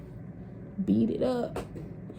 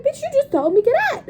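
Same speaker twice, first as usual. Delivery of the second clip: much higher, fast exasperated retort; indignant protest; 6 dB louder, faster than usual. Retold or imitated. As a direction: snap back at the auntie. Protesting to the auntie.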